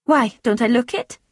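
sharp. A narrator's reading. Francesca snapping back at Tom's concern, sharp. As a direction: answer back sharply with a question. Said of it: "Francesca answered sharply".

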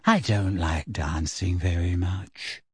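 slow, low dreamy musing; dreamy girl, slow and subdued; vague, thoughtful. A narrator's reading. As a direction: muse absently to yourself. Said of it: "she added thoughtfully".

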